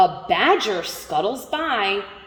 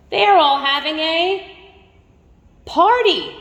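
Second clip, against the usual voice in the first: excited; 7 semitones higher, 4 dB louder than usual. Acted. Reader builds excitement to party.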